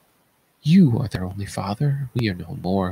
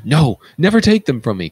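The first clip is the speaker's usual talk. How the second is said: loud, higher pleading outcry; anguished protest; 6 semitones higher, 7 dB louder than usual. Acted. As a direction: refuse with all your strength. Oedipus protests losing children.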